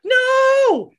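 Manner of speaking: imitating a bad actress screaming no, very high; hammed-up terror shriek; badly acted scream. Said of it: "it's the worst acting I've ever seen".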